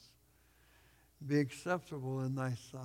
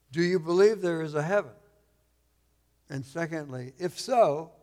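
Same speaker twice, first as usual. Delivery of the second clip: emphatic preaching; 3 semitones higher, 8 dB louder than usual. Acted. Survey questions, preaching.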